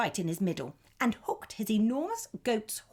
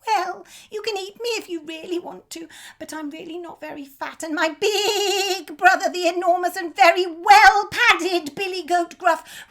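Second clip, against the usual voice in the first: high goat voice, bargaining; high goat talking its way out; pleading goat; 11 semitones higher, 11 dB louder than usual. A narrator's reading. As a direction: coax him, high and quick.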